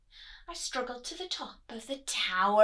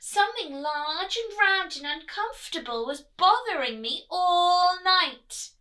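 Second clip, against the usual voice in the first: complaining; 6 semitones higher, 8 dB louder than usual. A narrator's reading. Princess complains to Queen.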